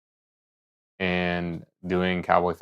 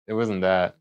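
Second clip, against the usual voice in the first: ordinary delivery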